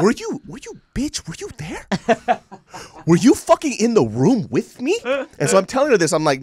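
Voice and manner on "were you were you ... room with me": high, loud exasperated rant; tearing into mom in the retelling; incredulous mock yell; mock outrage. Venting at mom, retold.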